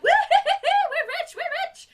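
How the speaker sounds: high, loud gloating whoop; gleeful cheer. Retold or imitated. Villains cheering over stolen money, loud gloating whoop. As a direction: crow over the loot, laughing.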